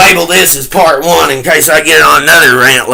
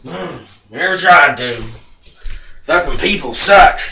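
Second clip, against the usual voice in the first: ordinary delivery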